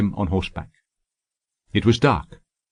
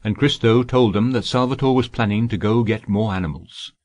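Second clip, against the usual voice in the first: usual speaking voice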